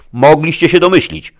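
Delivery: loud, high-pitched exasperation; angry retort. A narrator's reading. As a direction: snap back at the excuse. Angry client scolds the company. (głośno, z irytacją)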